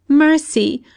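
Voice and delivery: high, loud pleading scream. Acted. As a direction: beg for mercy at the top of her voice.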